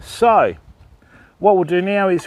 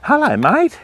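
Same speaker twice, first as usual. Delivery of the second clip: pet talk; 10 semitones higher than usual. Real, not acted. Greeting the animal in cage.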